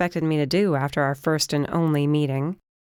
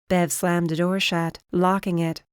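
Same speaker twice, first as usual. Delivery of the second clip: ordinary delivery, nothing marked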